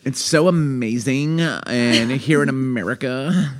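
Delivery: put-on high, slow American Valley Girl; exaggerated American Valley Girl accent. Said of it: "I can only do Valley Girl".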